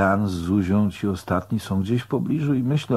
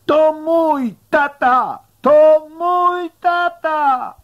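put-on very high, loud, slow child's shout; lone child's cry; 6 dB louder, much slower than usual. A narrator's reading. Little Forrest calls out over the shrieking crowd, high piercing cry. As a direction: stretch each word in a piercing high cry. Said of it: "nad te wszystkie wrzaski i krzyki wzbija się pojedynczy głos".

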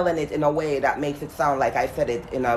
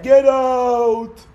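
ghostly shout, high and loud; spirit voice; 9 semitones higher, 7 dB louder than usual. Acted. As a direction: yell it like an angry ghost. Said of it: "The spirit said".

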